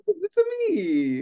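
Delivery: high, slow Mario catchphrase. Retold or imitated. Quoting Mario's catchphrase in a high cartoon Italian. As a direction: do the Mario line, hammed-up. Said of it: "And he just".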